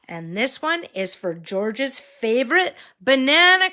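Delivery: sing-song picture-book voice; reading to a toddler. Acted. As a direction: point out each picture to a small child. Pointing at pictures for child.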